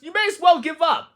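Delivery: high, mocking little bird voices; a robin heckling from the bush, high and mocking; jeering robins. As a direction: jeer at Toad like a cheeky robin. Robins jeer at Toad.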